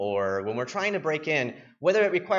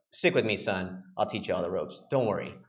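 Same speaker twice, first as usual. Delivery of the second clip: much lower, a touch softer executive voice; fatherly executive; 10 semitones lower, 3 dB quieter than usual. Retold or imitated. Executive reassuring the new intern, much lower fatherly voice. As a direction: talk down kindly like a big boss. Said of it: "And he's like".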